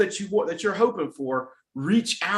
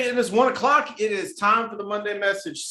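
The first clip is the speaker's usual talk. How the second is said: higher, louder showbiz announcement; upbeat announcer; 4 semitones higher, 4 dB louder than usual. Acted. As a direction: kick off the show like a radio host. Show opening announcement.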